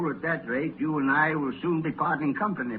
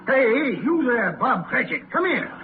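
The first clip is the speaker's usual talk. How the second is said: high, louder summons; sharp call; 8 semitones higher, 4 dB louder than usual. Acted. Scrooge calling his clerk away from the fire. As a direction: call him over sharply.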